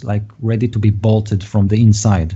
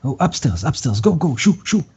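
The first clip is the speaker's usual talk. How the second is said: hurried in-character shooing, rising higher on the go's; shooing away; 5 semitones higher, faster than usual. Acted. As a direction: shoo them off in short repeated bursts. NPC shooing the party upstairs.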